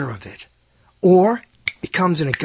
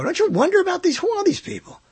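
wondering aloud; 13 semitones higher than usual. Real, not acted. Rhetorical wondering to audience.

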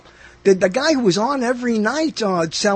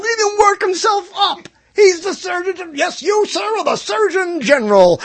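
higher, louder mock outrage; comic rant; 8 semitones higher, 4 dB louder than usual. Real, not acted. Joking to his guest about the Surgeon General, in a high mock rant. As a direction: work yourself up about the title.

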